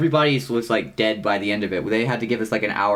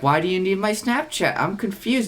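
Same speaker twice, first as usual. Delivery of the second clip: puzzled question, pitched high; a touch of high-voiced confusion; mildly baffled; 9 semitones higher than usual. Retelling his reply to the girl demanding his Snap, mildly baffled.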